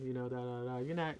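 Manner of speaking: hushed, very soft sales pitch; barely audible, as if underselling it; demonstrated whispering. Shown as a demonstration. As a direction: drop right down to a near whisper. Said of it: "I was, like, whispering".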